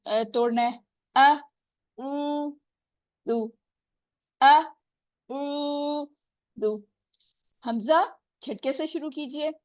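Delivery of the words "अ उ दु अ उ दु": अ, उ, दु broken into separate syllables; slow syllable split. Shown as a demonstration. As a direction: break the word into sounds. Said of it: "syllable में इसको तोड़ना है".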